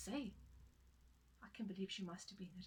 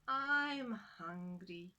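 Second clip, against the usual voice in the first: very slow, louder groaned complaint; groaning hunger; 5 dB louder, much slower than usual. Acted. Hungry wife complaining to husband, slow and groaning. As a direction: drag it out like a starving woman. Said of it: "The woman groaned".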